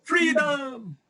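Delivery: high battle-cry yell of freedom; Braveheart shout. Retold or imitated. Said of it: "Sigaw ko ulit".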